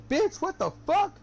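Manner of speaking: high, loud retold outburst; a sudden indignant yell; snapping back. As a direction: snap at the kid, shocked and mad. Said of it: "like, I snapped".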